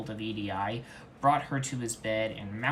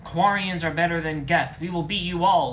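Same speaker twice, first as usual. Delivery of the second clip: loud high screaming taunt; furious shouting taunt; 7 semitones higher, 10 dB louder than usual. Acted. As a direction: scream at the rival. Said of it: "screamed Tally".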